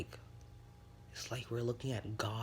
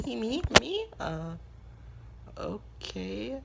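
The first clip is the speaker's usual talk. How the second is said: much higher and louder, flustered; squeaky surprise; 12 semitones higher, 4 dB louder, much slower than usual. Acted. Mei surprised Izuku invites her, squeaky high. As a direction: jump way up in pitch, caught off guard.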